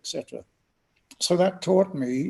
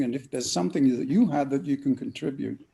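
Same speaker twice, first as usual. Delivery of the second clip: ordinary delivery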